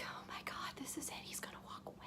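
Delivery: hushed, breathy whisper to oneself; retold inner panic, all whispered; panicked whisper. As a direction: whisper it in mock dread. Her panicked inner thought, retold.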